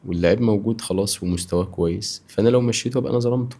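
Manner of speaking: normal talking voice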